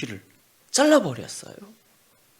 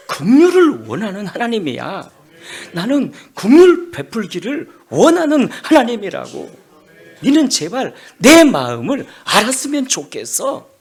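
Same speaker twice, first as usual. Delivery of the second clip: louder, pleading declaration of mercy; passionate plea; 8 semitones higher, 12 dB louder than usual. Acted. God pleading with Jonah about his mercy. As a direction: plead from the heart, swelling.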